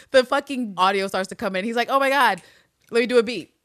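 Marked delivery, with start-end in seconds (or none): 1.9-3.5 loud, high, thrilled quote; pitched up and bursting with enthusiasm; excited. Acted. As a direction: act out giddy eagerness. Voicing Hugo thrilled by the audio.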